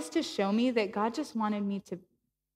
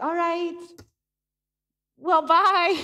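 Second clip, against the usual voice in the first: deflated goodbye; 7 semitones higher, 5 dB louder, slower than usual. Retold or imitated. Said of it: "I just felt so deflated".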